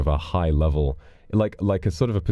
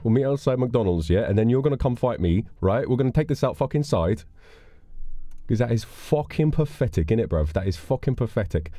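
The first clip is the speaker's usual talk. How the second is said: higher London roadman street voice; Roadman accent; 7 semitones higher than usual. Retold or imitated. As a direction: play a cocky roadman. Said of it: "I'm able to do a Roadman impression".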